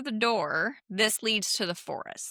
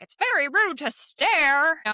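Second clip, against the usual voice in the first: cranky door knocker; 8 semitones higher, 5 dB louder than usual. Retold or imitated. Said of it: "he's like".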